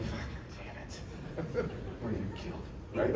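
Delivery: low, soft muttering to himself; muttered curse. Retold or imitated. Dad cursing to himself while fetching lunch, soft mutter. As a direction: grumble it under your breath.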